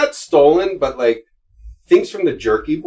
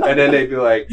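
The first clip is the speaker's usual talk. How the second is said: ordinary delivery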